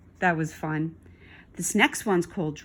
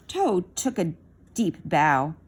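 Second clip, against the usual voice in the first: plain reading voice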